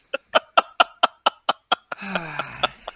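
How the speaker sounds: long airy cackle in separate bursts; maniacal villain laugh. Acted. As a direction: cackle at your own insincerity. Villain laughs after mock flattery.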